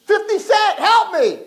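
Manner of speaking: high desperate scream; screaming for help. Acted. King in the boiling pot screaming for help. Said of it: "he started to scream the name".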